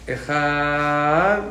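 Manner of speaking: Echad stretched mostly on the Het, a little on the Dalet; drawn-out Echad demo; elongated Echad. Shown as a demonstration. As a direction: lean long into the Het of Echad. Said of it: "más en la Het y un poquito en la Dalet".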